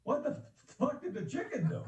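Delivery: high, squawky parrot voice with a laugh; parrot punchline. Retold or imitated. Comic telling the joke, squeaking the frozen parrot's punchline. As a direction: pitch it up like a rattled parrot. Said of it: "He said".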